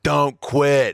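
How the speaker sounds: high, loud blunt command; very direct. Retold or imitated. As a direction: punch it out as an order. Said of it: "was a very direct".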